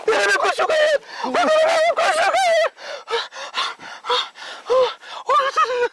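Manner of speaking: high, hysterical cry; terrified accusation. Acted. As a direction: shriek it at him in panic. Terrified friend is possessed. (दहशत में चीखते हुए)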